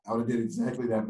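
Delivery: usual speaking voice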